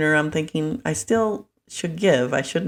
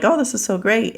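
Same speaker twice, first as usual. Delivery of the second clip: higher, gushing mock enthusiasm; people-pleaser delight; 7 semitones higher than usual. People pleaser among friends exchanging gifts, gushing. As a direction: gush as an eager gift giver. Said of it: "I was a people pleaser".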